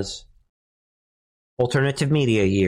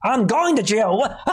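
high-pitched mock disbelief; incredulous new inmate; 9 semitones higher than usual. New inmate jailed over a lawnmower asks about another prisoner, incredulous. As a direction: pitch it up like a baffled convict.

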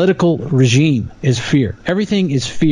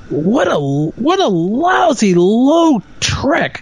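high, slow, indignant outburst; upset; 8 semitones higher, much slower than usual. Real, not acted. Host venting about the fear ad, indignant and high. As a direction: stretch out the outrage, pitched way up. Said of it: "You can tell I'm a little upset about it".

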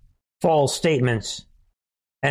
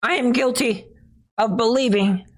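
high, tearful mock pleading; mock whiny soldier; 10 semitones higher than usual. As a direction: squeal out a weak denial. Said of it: "they're like".

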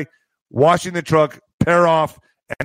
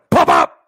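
sharp, loud double pop; imitated bang; 5 dB louder than usual. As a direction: mimic two quick bangs. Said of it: "We heard a".

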